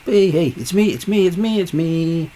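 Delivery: high, repeated attention-getting call; insistent calling. Retold or imitated. Voicing God trying to convince you, insistent high calling. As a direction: keep calling until they notice. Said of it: "call your name many times".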